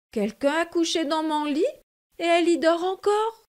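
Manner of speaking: put-on small bear startled by a sleeping girl; surprised baby bear. A narrator's reading. Baby bear finds Goldilocks in his bed, surprised. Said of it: "s'exclama bébé ours tout surpris".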